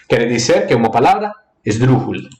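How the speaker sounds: normal talking voice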